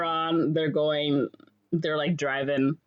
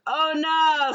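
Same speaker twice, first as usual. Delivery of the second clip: high, louder "oh no"; mock dismay; 10 semitones higher, 5 dB louder than usual. Couple about to kiss as rain starts, mock dismay. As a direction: imitate a couple caught by rain.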